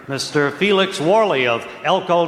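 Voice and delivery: ordinary talk, nothing marked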